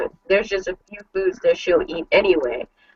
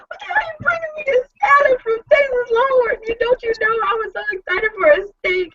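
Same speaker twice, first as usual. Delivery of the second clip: much higher, fast, laughing shout; laughing mock outrage; faster than usual. Wife yelling at husband over salad, laughing mock outrage. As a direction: yell at him in hammed-up disbelief.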